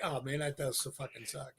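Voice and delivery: low, very soft aside to self; muted retold reaction. Recalling own reaction to the villain's bad luck, muttered quietly. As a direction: imitate muttering under the breath, low. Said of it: "I laughed at that 'cause I'm like".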